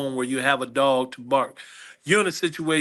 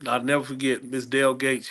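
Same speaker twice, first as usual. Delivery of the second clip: usual speaking voice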